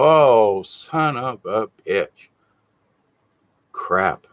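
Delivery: slow, low sinking curse; mock dejected self-reproach; rueful realization. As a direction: let it dawn on you, drawn out. Acting out rueful realization of own fault.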